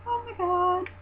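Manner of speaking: high-pitched fangirl swoon; re-enacted giddy squeal; starstruck squeal. Re-enacts reaction to wrestler looking.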